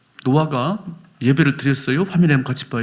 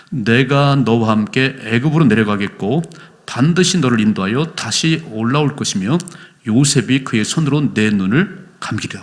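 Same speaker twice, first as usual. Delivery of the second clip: scripture reading; 2 semitones lower, 4 dB louder than usual. Acted. Reads God's words aloud. (성경 구절을 크고 천천히 낭독)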